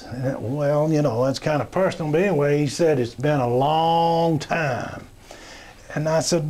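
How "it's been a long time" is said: slow drawn-out reply. Retold or imitated. Big guy answers in the shower.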